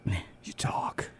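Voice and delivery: mock Walken whispering to the host. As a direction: hush it down. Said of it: "and was like".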